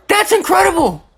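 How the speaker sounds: very high thrilled exclamation; high-pitched, hyped-up awe; amazed excitement. Real, not acted. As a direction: cry out in real amazement. Thrilled reaction to wrestling clip.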